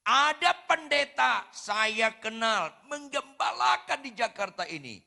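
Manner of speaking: loud, slow preacher's cry; preaching at full force. Acted. Pastor thundering at the congregation, preaching loud. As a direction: preach it out to the hall.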